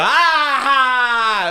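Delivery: high mock laughing cry; loud, high "ah-ha"; imitated cackle. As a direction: re-enact rowdy kids' laughter. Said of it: "And we go".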